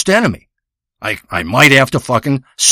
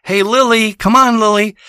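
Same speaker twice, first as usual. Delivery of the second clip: higher, sweet coaxing pet call; 7 semitones higher than usual. Pretend. Mocking a dull pet name, higher sweet coaxing. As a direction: coax Lily over like a pet owner. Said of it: "you don't say".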